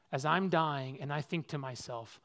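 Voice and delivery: ordinary talk, nothing marked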